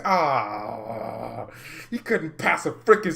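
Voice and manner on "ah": long drawn-out groan of frustration; exasperated groan. Real, not acted. Fan despairing at Werner's passing, exasperated groan. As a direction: groan "ah" at length, giving up on him.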